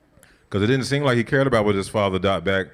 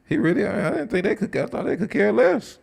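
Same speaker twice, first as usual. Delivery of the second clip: astonished Joseph; 13 semitones higher than usual. Acted. Joseph stunned by brother's plea.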